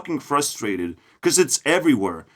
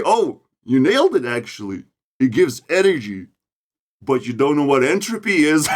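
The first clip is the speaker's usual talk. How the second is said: mocking a nerd; 3 semitones higher than usual. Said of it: "this is how we're gonna start a conversation".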